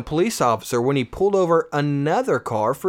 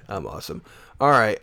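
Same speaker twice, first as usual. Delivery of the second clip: usual speaking voice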